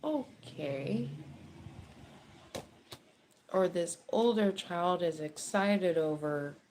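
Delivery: ordinary talk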